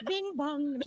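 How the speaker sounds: high sung two-note bing bong chime; train station chime. Pretend. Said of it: "I can do the train station too".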